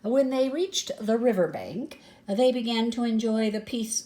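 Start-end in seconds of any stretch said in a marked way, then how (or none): none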